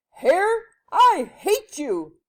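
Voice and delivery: high, loud, drawn-out shout; furious storybook man yell. A narrator's reading. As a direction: roar it at the hair. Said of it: "The man yelled".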